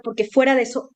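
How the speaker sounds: ordinary talk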